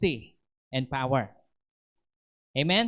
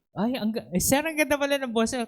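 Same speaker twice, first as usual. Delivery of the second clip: much higher, fast delighted surprise; amazed mentor; 9 semitones higher, faster than usual. Retold or imitated. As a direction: gasp it out, caught off guard by the voice.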